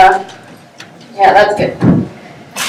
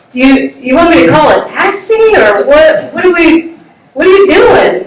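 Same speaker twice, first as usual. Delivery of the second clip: baffled question; 6 semitones higher than usual. Retold or imitated. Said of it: "I'm like".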